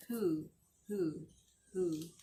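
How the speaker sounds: usual speaking voice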